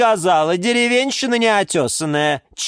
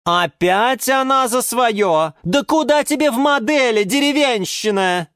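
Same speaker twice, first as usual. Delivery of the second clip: high-pitched haughty outcry; scornful yelling; 11 semitones higher, 3 dB louder than usual. A narrator's reading. Orchid mocks the geranium's dream, scornful yelling. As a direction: wail with contempt at a rival. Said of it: "завопила из своего кашпо орхидея Галатея".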